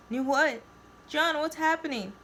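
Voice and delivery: high-pitched anxious questions; worried Kale's voice. A narrator's reading. Narrator voices frightened Kale.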